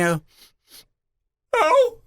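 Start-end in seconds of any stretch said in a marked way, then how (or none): 1.5-2.0 quick denial. Retold or imitated. Husband denying to his wife he's crying, high yelp. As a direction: squeak out a defensive denial.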